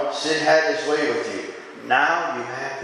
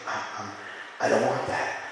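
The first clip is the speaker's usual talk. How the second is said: barely voiced, breaking off at the start; half-whispered, as if ashamed to say it; a soft, hesitant confession; hushed confession; 5 semitones lower, 7 dB quieter than usual.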